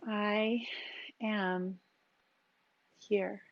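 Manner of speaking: each word set apart by a pause; "I", "am", "here" spoken singly with long gaps; slow, spaced-out mantra on the exhale. Shown as a demonstration. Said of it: "change the emphasis on those words".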